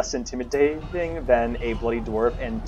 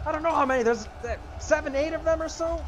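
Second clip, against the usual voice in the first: much higher, effortful character voice; straining crew worker. Acted. Worker asking big lad for help, high strained voice. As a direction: play a labourer straining at a load.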